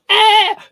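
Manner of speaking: very high, loud shrill scream; mock screaming women. Friend imitating women in spit fights, mock scream. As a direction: shriek like a panicking woman. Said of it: "women going like".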